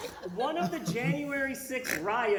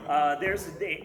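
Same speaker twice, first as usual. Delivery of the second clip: normal talking voice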